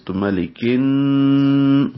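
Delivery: ملکن slowly chanted, the noon held nasal; nasal merge with ghunna. Shown as a demonstration. Teacher models the ghunna in ملکن, slow chant. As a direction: draw out ملکن with a humming ghunna. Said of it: "ادغام شمسی مع الغنہ".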